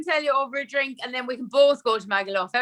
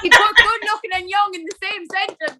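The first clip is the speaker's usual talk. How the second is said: laughing; 7 semitones higher, 6 dB louder than usual. Real, not acted. Joking about a compliment.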